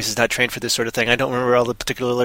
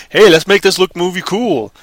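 mock studio exec; 4 semitones higher, 4 dB louder than usual. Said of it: "stuff that's like".

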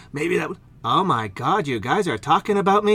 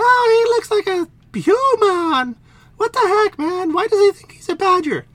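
high, loud indignant princess; high huffy princess; 6 dB louder than usual. Acted. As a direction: squeal it high and put out. Princess figure voice.

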